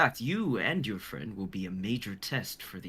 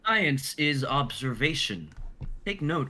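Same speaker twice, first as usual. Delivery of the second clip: ordinary talk, nothing marked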